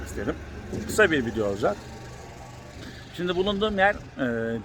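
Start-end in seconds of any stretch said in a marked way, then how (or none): none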